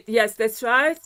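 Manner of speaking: ordinary delivery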